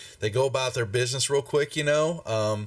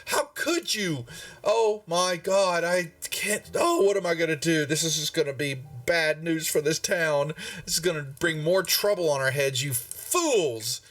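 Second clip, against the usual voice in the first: raised-pitch hand-wringing lament; upset town master; 6 semitones higher than usual. Acted. Town master berates adventurers over street violence, upset and high. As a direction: scold and fret in a higher voice. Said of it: "he was, he's upset".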